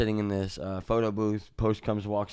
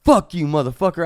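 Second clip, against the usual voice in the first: loud, higher retold curse; pissed-off drunk outburst; 7 semitones higher, 6 dB louder than usual. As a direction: act out drunk anger, raised voice. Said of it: "So it pissed me off".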